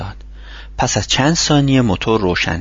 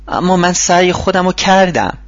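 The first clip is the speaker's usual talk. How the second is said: a pleading, higher whine; whining; 8 semitones higher, 4 dB louder than usual. A narrator's reading. Michael moans to Charlie that he tried, whining. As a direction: protest weakly that he did try. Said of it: "مایکل نالید".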